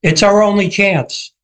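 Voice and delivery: mock calling back across water; shouting back. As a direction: raise the pitch as if shouting back. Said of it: "shouts back".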